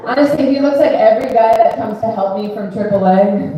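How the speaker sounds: normal talking voice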